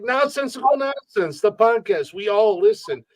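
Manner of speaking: high, eager fan voice; excited fan. Retold or imitated. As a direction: gush it like a listener meeting the host. Fan explains how he knows him.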